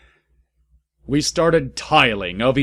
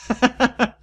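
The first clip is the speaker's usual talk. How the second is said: high giggle; 13 semitones higher than usual. Real, not acted.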